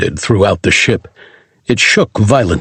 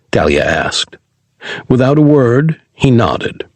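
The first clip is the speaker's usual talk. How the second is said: usual speaking voice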